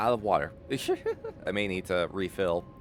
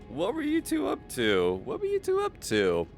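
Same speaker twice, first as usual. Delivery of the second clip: teasing; 11 semitones higher than usual. Acted. Teasing greeting to raiders.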